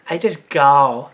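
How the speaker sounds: slow, exaggerated comic character voice; Mister Chow. Redoing the story line as Mister Chow, exaggerated impression. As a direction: drag it out in a put-on voice. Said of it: "like, Mister Chow for some reason".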